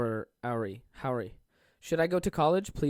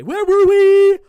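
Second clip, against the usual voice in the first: much higher, loud, slow sing-song squeal; silly falsetto; 14 dB louder, slower than usual. Pretend. Goofing around in a squeaky voice to his viewers.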